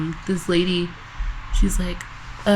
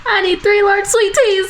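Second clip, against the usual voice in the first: higher, rushed customer order; mock loud fast order; 11 semitones higher than usual. Imitating a customer yelling his order into the headset. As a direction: blurt it loud and quick. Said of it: "he said it so loud and fast".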